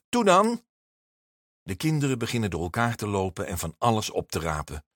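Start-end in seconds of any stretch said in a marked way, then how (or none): 0.1-0.6 high, loud push to get going; impatient teacher calling out; urging on. A narrator's reading. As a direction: hurry the children along. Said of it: "zegt meester Jaap".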